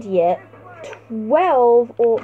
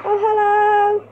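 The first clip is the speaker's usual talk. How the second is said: mock greeting; 8 semitones higher, 5 dB louder than usual. Real, not acted. Spots an enemy up high.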